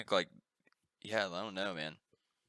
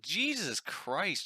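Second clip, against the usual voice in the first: stretched-out shock; 9 semitones higher, much slower than usual. Retold or imitated. Retold shock at price.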